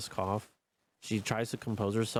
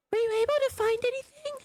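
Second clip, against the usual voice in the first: put-on very high, fast girl voice; rushed high-pitched little girl; man doing a woman's voice; 5 dB louder, faster than usual. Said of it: "It's better".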